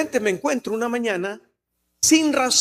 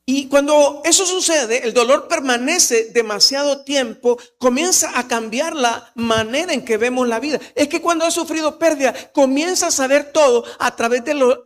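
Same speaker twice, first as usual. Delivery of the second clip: impassioned preaching; 4 semitones higher, 5 dB louder than usual. Acted. Pastor preaching about lasting pain to the congregation, loud and high. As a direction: drive it home to the church.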